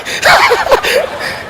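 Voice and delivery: high airy laugh; a real quiet wheezing laugh; breathless laugh. Real, not acted. Laughing at own story. (mahinang tawa)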